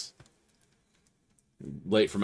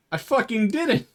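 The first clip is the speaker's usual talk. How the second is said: indignant denial; 4 dB louder than usual. Retold or imitated. Retold denial to cops.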